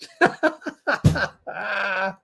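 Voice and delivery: high, sustained laughter; real helpless laugh; cracking up. Real, not acted. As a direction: burst out laughing at your own impression. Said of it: "I'm still laughing at that".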